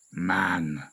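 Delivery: low, unruffled tiger voice; cool. A narrator's reading. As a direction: drop it low, utterly unbothered. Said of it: "said Shere Khan coolly".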